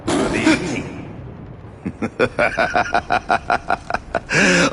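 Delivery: high, breathy stage laugh; theatrical villain laugh. Acted. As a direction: laugh dramatically from the shadows. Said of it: "forgive my theatrics".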